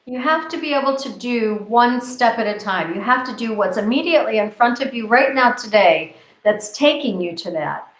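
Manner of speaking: ordinary talk, nothing marked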